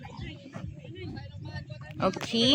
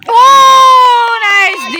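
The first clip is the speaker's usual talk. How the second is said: very loud, high whoop; thrilled cheer; 14 semitones higher, 13 dB louder, much slower than usual. Real, not acted. Spectator praising a defensive play, loud high shout. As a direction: burst out yelling praise for the play.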